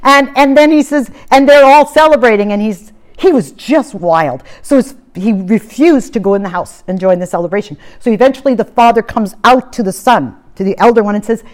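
normal talking voice

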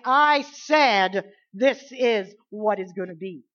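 slow, loud royal command; commanding king; king's decree. Retold or imitated. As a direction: proclaim it like a king. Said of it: "he would say".

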